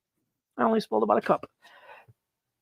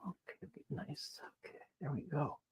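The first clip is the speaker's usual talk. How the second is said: murmur to self; 5 semitones lower, 21 dB quieter than usual. Real, not acted. Muttering to self while working.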